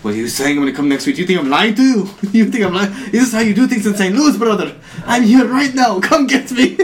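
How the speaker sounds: indignant, high-pitched mock Arabic accent. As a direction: build to loud outrage in a put-on Arabic accent. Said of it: "the Arabic accent was so great".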